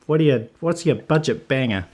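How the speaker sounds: ordinary delivery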